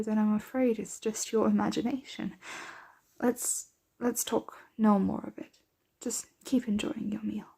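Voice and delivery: normal talking voice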